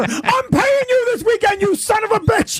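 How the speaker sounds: high-pitched, worked-up yelling; mock frantic shouting. Comic imitates his club boss cornering him, mock frantic shouting. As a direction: yell it high, like a boss demanding a plug.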